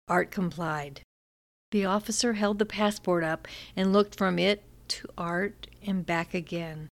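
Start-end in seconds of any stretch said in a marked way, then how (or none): none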